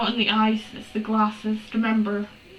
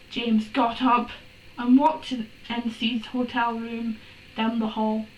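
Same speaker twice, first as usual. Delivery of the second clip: usual speaking voice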